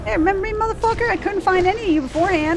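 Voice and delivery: high, loud, cocky taunting; gleeful taunt. Acted. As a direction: gloat at the monsters you finally found. Taunting enemies he found.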